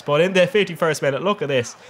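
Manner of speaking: ordinary talk, nothing marked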